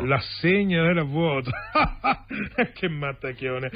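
carefree laughing; amused laughter. Acted. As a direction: laugh it off as a good joke. Laughs off the bad news. (ridendo divertito)

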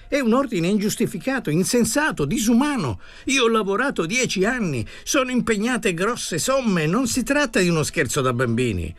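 più acuto, supplica concitata; supplica accorata. Acted. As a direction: implora di non chiudere la torre. Buscaino supplica il commendatore. (Buscaino implora il funzionario, voce acuta e concitata)

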